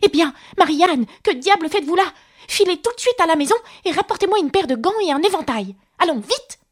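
high-pitched, irate rabbit giving orders; angry. A narrator's reading. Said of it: "il l'interpella avec colère". (interpellation en colère, voix aiguë)

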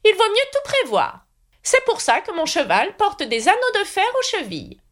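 put-on high, fussy old knight voice; high knightly voice promising to escort Alice. A narrator's reading. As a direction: play the kindly, dotty knight up high. Knight rambles.

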